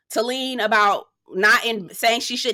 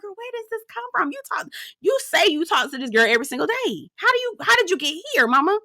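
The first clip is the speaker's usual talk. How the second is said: much higher, fast, disbelieving questions; incredulous; 8 semitones higher, faster than usual. Retold or imitated. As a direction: ask her how she got here, stunned. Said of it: "I'm like".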